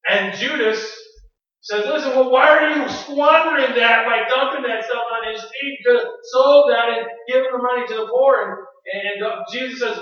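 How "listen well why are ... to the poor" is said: raised, louder acted reproach; indignant objection. Preacher as Judas scolding Mary, indignant objection. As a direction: protest at the waste.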